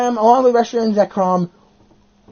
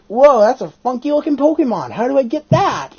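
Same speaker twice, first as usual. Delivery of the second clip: higher, wowed quoted reaction; amazed reaction; 6 semitones higher, 3 dB louder than usual. Retold or imitated. Player first seeing a funky Pokemon, amazed reaction. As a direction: marvel at it with a raised pitch. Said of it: "And I was like".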